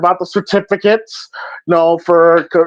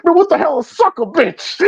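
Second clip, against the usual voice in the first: confused Martin; 8 semitones higher than usual. Retold or imitated.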